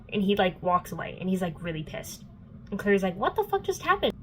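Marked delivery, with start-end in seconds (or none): 3.2-4.1 high-pitched mock bewilderment; baffled disbelief. Clary after Jace walks away angry, baffled. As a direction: voice a stunned girl's disbelief.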